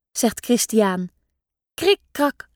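ordinary delivery, nothing marked

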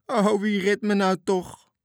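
high, loud, slow lament; old sailor wailing to his rescuers. A narrator's reading. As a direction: wail it out in despair. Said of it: "Kromme Arie jammerde".

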